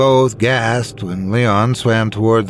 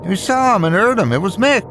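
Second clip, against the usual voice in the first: lightly voiced insistent woman; 9 semitones higher than usual.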